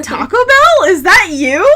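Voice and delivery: high, loud incredulous cry; mock disbelief. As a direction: call out to the brand in shock. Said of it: "and I'm like".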